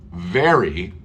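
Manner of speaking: very with a buzzing v, not berry; clear very. Shown as a demonstration. Teacher repeating very for the class. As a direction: bite the lip for the v in very. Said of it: "is a magnifier".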